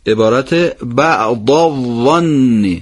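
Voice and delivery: slow, بعض with a clear Arabic ض before الظن; Arabic ض sound. Shown as a demonstration. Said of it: "چون حرف زاد تو تلفظ یه گونه هست". (ادای فصیح ض و ظ)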